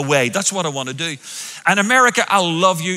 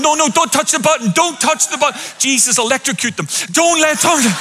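high, fast mock panic; panicked driver; 7 semitones higher than usual. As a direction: beg the pedestrian not to press. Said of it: "I'm going".

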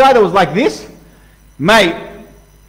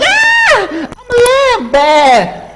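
squeaky, much higher bleating plea, drawn out; timid lamb; much slower than usual. Pretend. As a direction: play a frightened little lamb facing a bear. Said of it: "You would just be a lamb".

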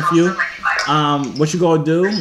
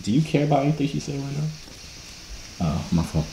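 whispered aside; 9 dB quieter than usual. Real, not acted. Host quietly asks the boys if they care what she said, hushed. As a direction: drop your voice for a private remark. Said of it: "I whispered".

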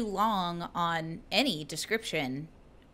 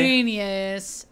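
loud, stretched-out fake praise; mock contempt; 5 dB louder than usual. Tolkien mocking his rival's ideas, sarcastic awe. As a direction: gush with heavy sarcasm. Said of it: "thought his stories were, like, such baby shit".

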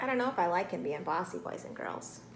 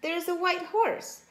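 higher excited rooster cry; understated storybook shout, pitched up; mildly screamed; 8 semitones higher than usual. A narrator's reading. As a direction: point out a startling sight. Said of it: "screamed Chicken Chuck".

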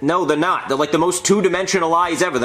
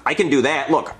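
usual speaking voice

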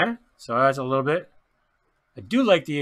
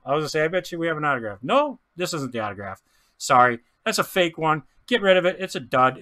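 ordinary talk

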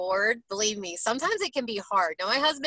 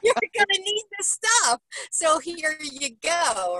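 a high, loud, laughing voice of God handing over a gift; playful God voice; 6 semitones higher, 3 dB louder, slower than usual. Retold or imitated. Host imagining God giving plants to people, high, laughing and playful. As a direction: play God as a cheerful giver, laughing. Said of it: "He was like".

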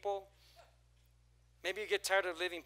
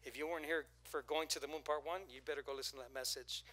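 ordinary talk

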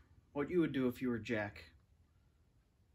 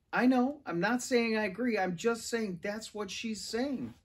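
defensive; 6 semitones higher than usual. A narrator's reading. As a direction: insist you're only passing it on. Defensive reply in argument.

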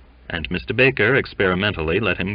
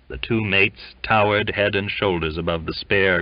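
ordinary delivery, nothing marked

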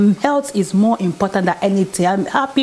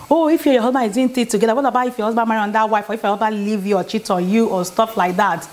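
people's question; faster than usual. Retold or imitated. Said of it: "People always ask me".

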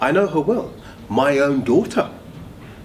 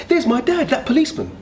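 urgent exclaim; 12 semitones higher, 4 dB louder than usual. Acted. Coral's ruse to scare passenger.

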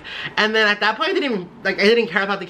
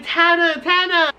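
very high, drawn-out squeal of the name; excited fan calling; 11 semitones higher, slower than usual. Retold or imitated. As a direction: call out to your idol, high and thrilled. Retold excited call to idol.

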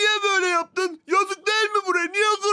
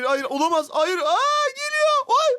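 ordinary talk, nothing marked

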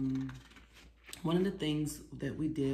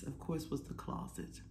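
whisper; 7 dB quieter than usual. Real, not acted.

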